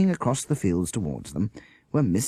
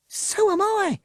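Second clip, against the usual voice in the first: put-on high, loud breathless child's cry; gasped cry; 4 dB louder, slower than usual. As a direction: blurt it out as the dogs trip her. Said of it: "gasped Avril".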